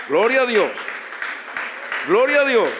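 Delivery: loud, high acclamation; short ringing shout of praise. Real, not acted. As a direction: cry it out to the congregation. Preacher shouts praise to congregation.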